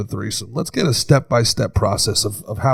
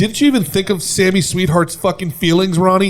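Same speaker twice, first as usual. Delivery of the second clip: mock scolding; 6 semitones higher than usual. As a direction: scold him in a higher, theatrical voice. Mock scolding from reality show.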